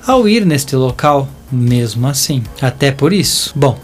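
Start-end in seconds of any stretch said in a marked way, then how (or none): none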